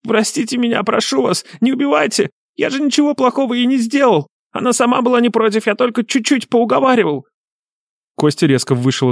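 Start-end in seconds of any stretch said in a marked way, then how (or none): 0.1-5.7 very high, whining plea for mercy; bleating wail. A narrator's reading. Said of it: "мерзкий блеющий голос снова заголосил". 5.7-7.3 high, whining excuse; pitiful falsetto self-defence; bleating wail. A narrator's reading. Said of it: "мерзкий блеющий голос снова заголосил".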